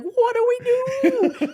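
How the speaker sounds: high, slow, squeaky wail; panicked ant. Retold or imitated. Imitating a colleague as a panicking Bugs Life ant, high and slow. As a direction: stretch it out like a lost cartoon ant. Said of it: "That's how he was acting".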